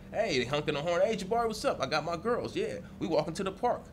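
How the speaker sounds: cheerful greetings. Retold or imitated. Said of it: "but now it's like".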